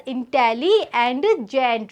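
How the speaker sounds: "intelli and gent", the word intelligent broken in two; split with pause. Shown as a demonstration. Teacher showing students where the little pause is, "intelli" and "gent" separated. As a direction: set "intelli" apart from "gent" with a small pause. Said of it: "A little pause is there".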